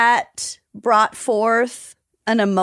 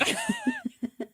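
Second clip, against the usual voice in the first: high laugh; 11 dB quieter than usual. Real, not acted.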